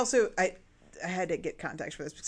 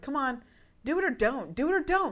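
exasperated; 6 semitones higher than usual. Retold or imitated. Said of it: "I'm like".

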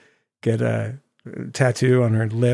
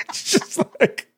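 high laugh; 6 dB quieter than usual. Real, not acted. Laughing at the joke.

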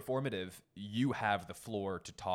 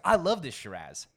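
eager enthusiasm; 7 semitones higher, 9 dB louder than usual. Retold or imitated. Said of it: "And I was like".

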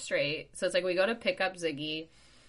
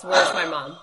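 dog's voice; 11 dB louder than usual. Retold or imitated. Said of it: "He goes".

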